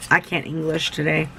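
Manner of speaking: normal talking voice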